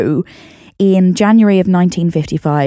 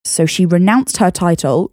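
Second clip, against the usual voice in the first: ordinary delivery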